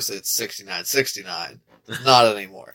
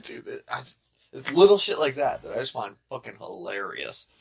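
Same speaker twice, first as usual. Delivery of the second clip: usual speaking voice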